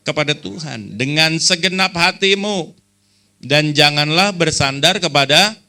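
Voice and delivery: loud, slow unison scripture reading; preacher leading the verse with the crowd; congregational reading. Real, not acted. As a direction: lead the church reading aloud, drawn out. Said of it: "kita baca sama-sama".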